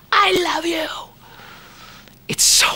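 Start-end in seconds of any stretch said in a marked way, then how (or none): 0.1-0.9 shrieked at top of lungs, much higher; fan scream. Retold or imitated. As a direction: scream it like fifty thousand fans. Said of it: "screaming at the top of their lungs".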